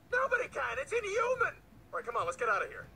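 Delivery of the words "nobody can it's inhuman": high-pitched despairing protest; overwhelmed outburst. Acted. Giving up on impossible delivery job, overwhelmed outburst. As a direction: protest at the top of your range.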